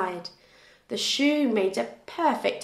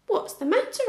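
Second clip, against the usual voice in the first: George asking, pitched up and curious; raised questioning voice; 10 semitones higher, faster than usual. A narrator's reading. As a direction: ask kindly with a lifted voice. George asks the animal.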